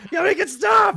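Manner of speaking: high, loud cry for it to stop; frantic shout. Real, not acted. Host to co-host as the phone won't turn off, frantic. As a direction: shout over the noise, pitch shooting up. Phone alarm will not switch off.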